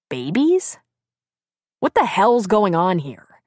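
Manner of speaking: puzzled rise into emphatic demand; astonished. A narrator's reading. Confused husband hears of babies, astonished. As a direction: demand answers in bewilderment.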